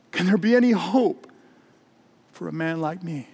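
acted despairing question; desperate plea. Desperate man begs for hope.

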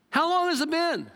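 ordinary talk, nothing marked

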